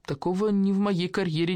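plain reading voice

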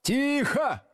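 very high-pitched sharp bark; barked command. A narrator's reading. Teacher to noisy class, barking. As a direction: bark for silence. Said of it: "гаркнул Слушкин".

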